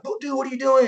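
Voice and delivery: high, airy imitation of a buddy. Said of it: "who be like".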